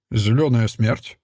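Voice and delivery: ordinary delivery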